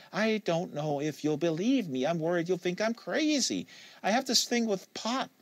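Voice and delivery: Kate, nervous about being believed; Kate, hesitant confession. Acted.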